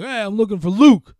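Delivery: calling at door. Retold or imitated. Mechanic banging on the door, calling out loudly. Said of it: "like, banging on the door".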